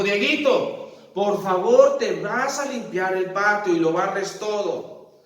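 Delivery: a mom laying down the chore; mother's order. Pretend. Mamá mandando a Dieguito a barrer, voz alta y mandona.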